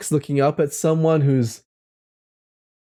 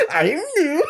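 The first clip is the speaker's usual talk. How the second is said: squeaky, very high "aw, shucks" voice; mock-flattered, high-pitched cartoonish voice; silly put-on voice; 13 semitones higher than usual. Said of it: "I'll never do that voice".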